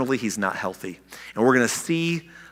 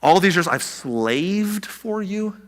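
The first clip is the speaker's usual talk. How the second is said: slow, higher disbelieving question; incredulous echo; 6 semitones higher, much slower than usual. Shown as a demonstration. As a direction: repeat it back as if you can't believe it. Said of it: "That's a strong statement, isn't it?".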